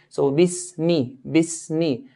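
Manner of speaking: bismi slowly, clear i on b and m; slow clear kasra. Shown as a demonstration. Teacher slowly models bismi with clear kasras. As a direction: stretch bismi gently, every i clear. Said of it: "pronounce with the kasra".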